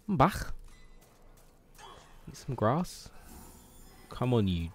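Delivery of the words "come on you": ordinary talk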